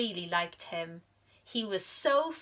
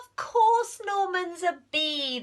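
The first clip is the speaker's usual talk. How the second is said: high, dismissive chorus; bees scoffing; 10 semitones higher, 4 dB louder than usual. Acted. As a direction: brush off her worry. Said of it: "they said".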